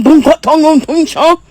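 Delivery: loud, hammed-up fake African accent; mock African accent. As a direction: nonsense syllables in a pretend African accent. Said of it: "with some type of accent if we all from Africa".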